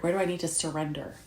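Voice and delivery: normal talking voice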